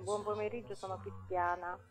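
quiet voice over a phone line; radio show caller. Real, not acted. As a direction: caller heard through the telephone.

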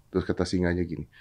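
usual speaking voice

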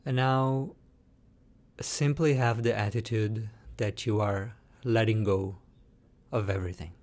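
guided meditation. Shown as a demonstration. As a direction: lead them calmly, letting each phrase settle into silence.

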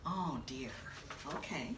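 a hushed, dismayed aside. Real, not acted. Storyteller to herself after the rule, hushed dismay. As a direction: mutter it under your breath in dismay.

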